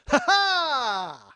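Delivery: sarcastic shouted laugh; loud ironic ha ha; bitter laugh. Retold or imitated. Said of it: "and I went".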